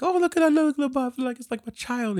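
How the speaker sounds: very high, cooing mock voice; high little adoring imitation; imitating doting inventor. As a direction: coo over it like a proud parent. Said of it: "and he's like".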